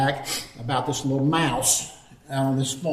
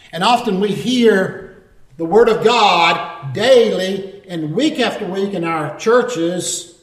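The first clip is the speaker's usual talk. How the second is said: louder, higher sermon delivery; emphatic preaching; 4 semitones higher, 5 dB louder than usual. Real, not acted. Preacher urging churchgoers to act on scripture, emphatic preaching. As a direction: drive the moral home to the congregation. Preacher drives the moral home.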